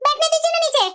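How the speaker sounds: usual speaking voice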